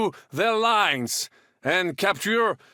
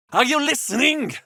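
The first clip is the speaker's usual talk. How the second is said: exasperated; 9 semitones higher than usual. Acted. Exasperated language teacher.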